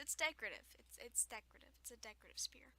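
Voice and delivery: normal talking voice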